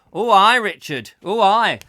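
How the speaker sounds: loud hammed-up northern "Oh, aye"; mock northern. Hamming up the north to Richard, loud mock accent. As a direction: bellow it like a Yorkshireman.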